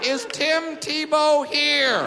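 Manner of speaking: drawn-out, wondering aloud; slowly looking around confused; slow mock-puzzled ask; slow puzzled question. Comic looking around onstage.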